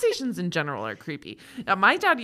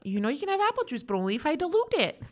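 mom voice; 7 semitones higher than usual. Pretend. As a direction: coax a toddler gently. Mom voicing herself to kids.

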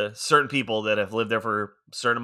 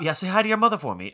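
over-the-top Boston Wahlberg; 8 semitones higher, faster than usual. Retold or imitated. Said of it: "Wahlberg's was over the top".

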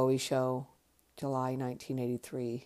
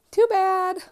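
squeaky much higher, louder taunt; playful defiance; 10 dB louder than usual. Real, not acted. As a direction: fling it at the critics in a silly high voice. Defiant jab at viewers.

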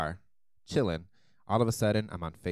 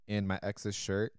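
usual speaking voice

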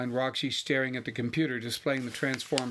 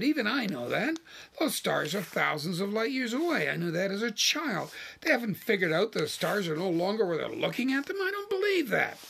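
high, exasperated rant climbing to the end; incredulous outrage; 7 semitones higher than usual. A narrator's reading. Wife ranting that scientists missed the obvious, incredulous outrage. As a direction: rant in disbelief, pitch soaring on the last words.

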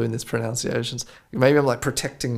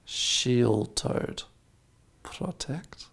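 whispered to self; 7 dB quieter, much slower than usual. Real, not acted.

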